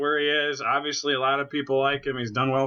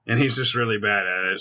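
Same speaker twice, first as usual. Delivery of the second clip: normal talking voice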